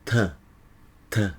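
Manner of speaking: tongue tip taps behind the upper teeth for "T"; T sound. Shown as a demonstration. Teacher showing students where the tongue goes for "T". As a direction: tap out a light "t". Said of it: "Fazem assim".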